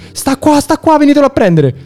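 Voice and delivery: frantic high-pitched plea; panicked. Retold or imitated. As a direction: cry out for help in a panic. Panicked call to the police.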